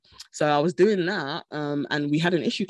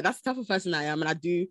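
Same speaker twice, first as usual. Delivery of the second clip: ordinary talk, nothing marked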